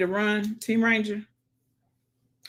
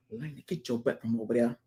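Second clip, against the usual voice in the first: lower, softer stern warning to the pet; low mock scolding; 6 semitones lower, 6 dB quieter, faster than usual. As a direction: growl it out at the pet like a stern parent.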